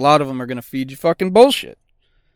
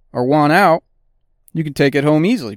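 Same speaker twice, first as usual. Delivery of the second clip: ordinary talk, nothing marked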